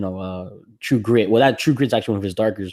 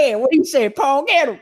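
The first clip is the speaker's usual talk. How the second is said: hillbilly sidekick; 6 dB louder than usual. Retold or imitated. Host acting a Western sidekick cheering on a fight, high and loud. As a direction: egg on the outlaw like a toothless sidekick. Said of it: "the hillbilly guys".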